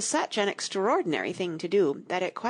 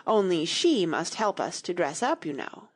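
ordinary delivery

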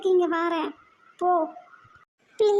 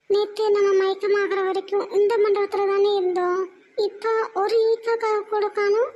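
ordinary talk, nothing marked

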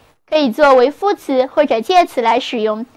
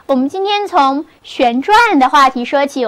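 normal talking voice

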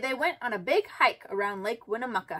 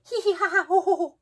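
higher, helpless giggling; tickled giggle; 7 semitones higher, 3 dB louder, faster than usual. A narrator's reading. Froggy tickled by a feather duster, giggling. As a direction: laugh like you're being tickled.

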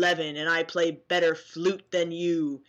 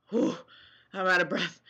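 normal talking voice

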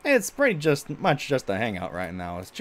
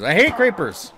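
annoyed; 7 semitones higher, 7 dB louder than usual. Real, not acted. Frustrated at creepers in game.

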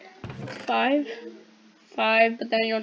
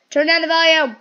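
loud, higher-pitched call to people nearby; annoyed yell; 6 semitones higher, 12 dB louder than usual. Real, not acted. Player telling TV watchers off, annoyed yell. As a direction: yell it across the room.

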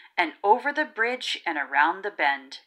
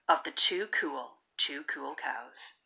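fading softly; 7 semitones lower, 9 dB quieter than usual. A narrator's reading. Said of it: "bedtime ending fade".

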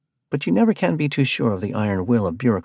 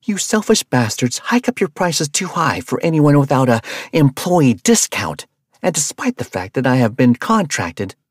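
loud, high, incredulous fury; shouting down the van master; outraged yelling; 6 semitones higher, 6 dB louder than usual. Acted. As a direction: scoff then rage at him. Rabia outraged at buying supplies.

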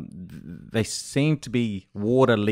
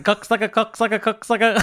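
high, hurried slurred twister; mock fast slip; 9 semitones higher than usual. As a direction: blur it into a fast garbled version. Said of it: "'cause I'll be saying".

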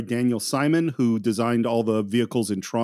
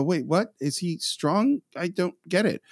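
puzzled higher-pitched questioning; confused; 6 semitones higher than usual. Acted. Podcaster imitating a viewer not paying attention, confused. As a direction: voice a baffled casual viewer.

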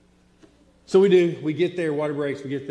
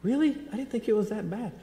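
high, breezy mock of his wife; airy wife's voice; 12 semitones higher, faster than usual. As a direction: tease her careless tone. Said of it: "And she was like".

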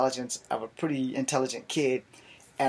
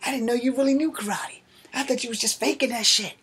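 high, amazed big-guy voice; impressed coworker; 11 semitones higher than usual. Retold or imitated. Coworker Chris praising the fight, high and amazed. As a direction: marvel at it, pitched way up. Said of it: "He's like".

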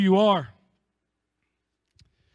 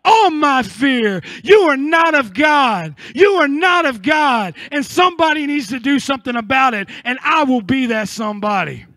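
defiant declaration; 3 semitones higher, 3 dB louder than usual. Acted. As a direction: declare it against your fear. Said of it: "look at that complacency ... and say".

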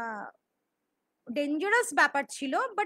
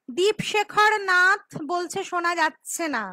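loud; 8 dB louder than usual. Real, not acted. Reading comment while loud.

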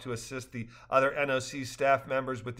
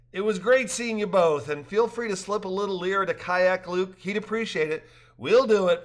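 higher, louder lively dialogue; cheerful characters; 7 semitones higher, 5 dB louder than usual. A narrator's reading. Rafters joking after the trip, higher and louder. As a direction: voice the rafters brightly.

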